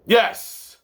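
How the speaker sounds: ordinary talk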